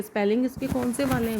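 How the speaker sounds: ordinary delivery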